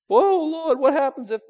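high, startled cry to the Lord; mock panic. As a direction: yelp like a frightened believer. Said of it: "mock panicked prayer".

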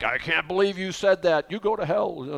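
mildly hammed-up indignation; stressed-out outburst. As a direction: imitate someone offended and lashing back. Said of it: "so stressed out, like".

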